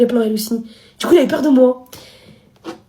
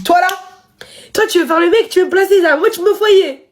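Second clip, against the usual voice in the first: mock angry father; 7 semitones higher than usual. Father's accusation imitated.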